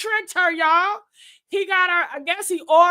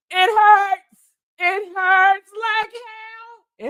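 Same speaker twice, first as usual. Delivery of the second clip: high, drawn-out comic wailing; mock wail of pain; 5 semitones higher, 4 dB louder, much slower than usual. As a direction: wail it out in hammed-up agony.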